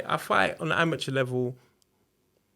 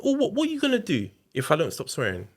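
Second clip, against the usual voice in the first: taunting challenge; 7 semitones higher than usual. Retold or imitated. Partner's taunting quote.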